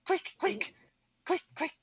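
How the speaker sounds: very high, hurried goblin squeak; a panicky little goblin shooing the others; frantic goblin. Acted. Goblin urging the others to fetch the chief, very high squeak.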